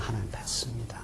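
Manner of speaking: whispered, humble admission; unvoiced whisper of repentance; hushed confession. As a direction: breathe it like a penitent confessing. People confessing to God.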